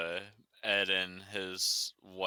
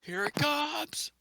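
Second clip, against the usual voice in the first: excited; slower than usual. Acted. Announcing the reveal playfully.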